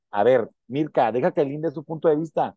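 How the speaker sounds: ordinary talk, nothing marked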